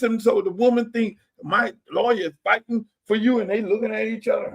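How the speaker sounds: ordinary talk, nothing marked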